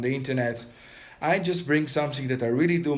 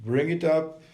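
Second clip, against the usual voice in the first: guided meditation. Acted. Teacher guiding viewers through a breathing meditation, calm and steady. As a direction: coach them through each breath and hold, pressing on hold it.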